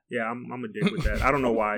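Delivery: normal talking voice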